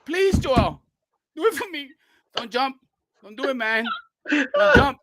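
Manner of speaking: high-pitched imitation of crying and begging; mock tearful pleading. Red begging Joel not to jump off the ledge, mock tearful pleading. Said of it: "Red starts to cry and goes".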